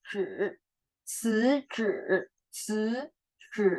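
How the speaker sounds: Zhi curled back, Ci thin and hissy; rolled-tongue contrast. Shown as a demonstration. Teacher contrasting tongue positions, retroflex Zhi against hissy Ci. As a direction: switch between flat-tongued Ci and curled Zhi. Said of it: "Zhi itu gulung banget".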